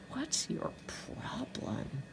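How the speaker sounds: slow, high, breathed whisper; whispered thought. A narrator's reading. Girl silently annoyed at her taunting classmate, whispered thought. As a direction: whisper it slowly like a private thought.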